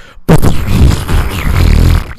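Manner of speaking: loud spluttering raspberry noise mimicking an explosive fart; imitated fart blast. Telling friends about a stranger's loud toilet blowout, loud imitated fart noise. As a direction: blow a long wet raspberry like gunfire. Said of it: "It was like".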